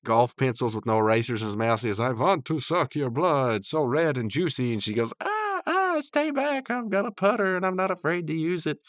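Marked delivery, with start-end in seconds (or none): 2.0-4.7 vampire imitation. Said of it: "Margaret, I'm a vampire". 5.2-8.8 very high, squealed imitation of a girl; mock alarm. Margaret wards off the pretend vampire, high-pitched mock fright.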